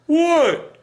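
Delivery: high shouted "What?" from another room; Larry yelling back. Retold or imitated. Larry shouting back from another room, annoyed. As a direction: call back irritated from far away.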